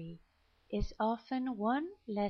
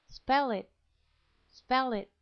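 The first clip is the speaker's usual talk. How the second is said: emphatic drill; 3 semitones higher, 6 dB louder than usual. Shown as a demonstration. Teacher drilling students on a key phrase, loud. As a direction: SPELL punched louder. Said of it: "teacher drilling key phrase".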